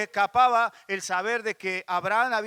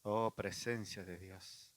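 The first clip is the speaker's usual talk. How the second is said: slow, hushed call to God's presence; quiet invocation of God; prayerful sigh; 12 semitones lower, 16 dB quieter, much slower than usual. Real, not acted. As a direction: breathe it out as prayer.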